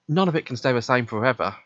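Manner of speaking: ordinary delivery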